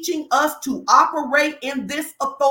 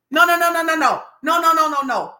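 loud, high string of no's; rapid-fire loud refusals; emphatic refusal; 3 semitones higher, 5 dB louder than usual. Real, not acted. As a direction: shut it down hard, no after no. Preacher refusing offense.

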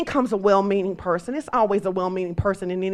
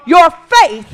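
shout; 7 semitones higher, 11 dB louder than usual. Real, not acted. Preacher shouts to congregation.

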